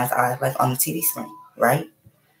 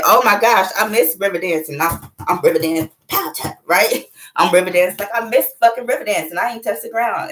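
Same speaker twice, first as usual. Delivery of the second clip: giddy excitement; 6 semitones higher than usual. Retold or imitated. Giddy about missing river dance.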